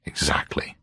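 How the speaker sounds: hushed, breathy whisper with no voice. A narrator's reading. Hutch whispering to Campion over the body. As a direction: breathe the word out secretly. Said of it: "said Hutch, glanced meaningfully".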